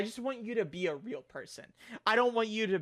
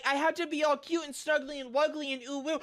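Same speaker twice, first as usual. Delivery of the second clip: mocking cutesy voice; 6 semitones higher than usual. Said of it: "you're like".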